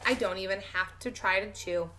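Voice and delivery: usual speaking voice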